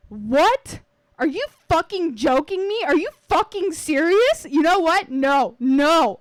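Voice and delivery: high, loud, furious yelling; furious outburst. Acted. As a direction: explode at your brother in disbelief. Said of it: "and she flipped".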